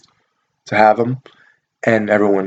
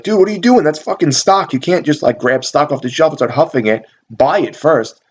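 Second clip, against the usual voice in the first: higher-pitched incredulous telling-off, easing down; exasperated scolding; 4 semitones higher than usual. Retold or imitated. Store clerk to a customer huffing stock, exasperated scolding. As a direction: reenact an exasperated protest.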